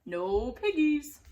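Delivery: slow, dull cow. Acted. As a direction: drone it like a lazy cow. Cow's reply.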